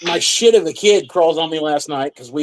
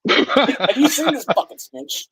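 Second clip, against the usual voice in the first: laughing; 9 semitones higher, slower than usual. Acted. Joking, mocking wanted-poster line.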